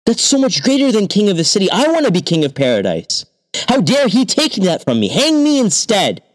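high, eager insistence; greedy king. A narrator's reading. King wanting paradise, high greedy insistence. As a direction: demand it like a spoiled child.

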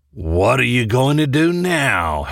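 slow, drawn-out villain's taunt; gloating taunt. A narrator's reading. Lucas corners them in the hall, slow gloating taunt. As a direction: taunt them slowly, on the edge of laughter. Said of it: "he said, laughing".

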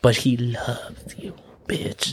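slow whisper, stretched out; whispered punchline. Acted. Rabbit insisting the lion loves the donkey, slow whisper. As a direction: whisper it slowly for emphasis.

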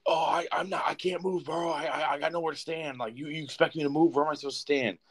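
high, whining protest; high-pitched mock grumbling; aggrieved old passenger. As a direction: imitate a put-out old guy complaining. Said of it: "he just looked angry at the world".

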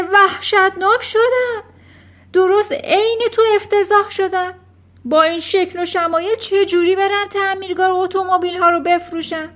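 high, loud wail of dismay; panicked father's loud lament; horrified yelling. A narrator's reading. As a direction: cry out aghast at your reflection. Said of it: "فریاد زد".

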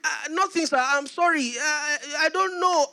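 high, hesitant apology; afraid. Retold or imitated. Afraid son answering his father, high and halting. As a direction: stammer nervously, acting the frightened son. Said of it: "Tanimola was afraid".

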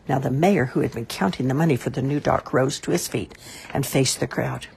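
ordinary delivery

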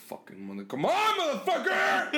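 simmer, then blow up, imitating dad; pissed-off dad. Son imitating dad's signature fury, building to a shout. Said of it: "really pissed off, he's like".

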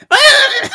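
high, loud mock shriek; hammed-up startled yelp; mock scream. Phoebe startled by noise.

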